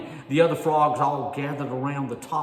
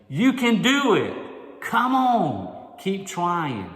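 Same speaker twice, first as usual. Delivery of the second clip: high, loud, slow cheer; encouraging call; 6 semitones higher, 7 dB louder, much slower than usual. Retold or imitated. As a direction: call out to lift him up. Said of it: "calling out to the Jeremiahs".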